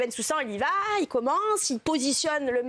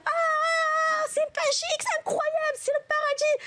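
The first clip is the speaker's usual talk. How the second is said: ecstatic high cry; 12 semitones higher, slower than usual. Acted. Wife crying out in pleasure as the fan blows, high and ecstatic. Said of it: "elle hurle".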